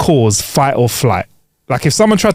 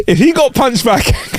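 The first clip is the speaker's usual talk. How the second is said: laughing while talking; 9 semitones higher than usual. Real, not acted. Laughing about their mate.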